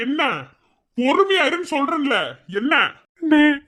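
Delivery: ordinary delivery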